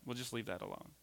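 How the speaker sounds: low, soft mock dismissal; quietly waving it off, acted; dismissive hushing. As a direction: back away from it in a low, hushed voice. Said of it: "and you say".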